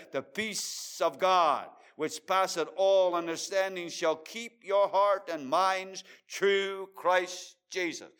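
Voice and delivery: slow, loud, high verse reading; solemn preaching voice; preached scripture. Acted. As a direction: lay each word down slowly.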